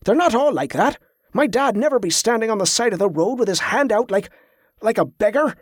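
stung, high-pitched objection; flustered protest. A narrator's reading. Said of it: "Wick flushed".

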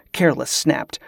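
usual speaking voice

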